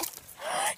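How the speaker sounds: sharp gasp of delight; high excited gasp. Real, not acted. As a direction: gasp with surprise. Dog finally on trampoline.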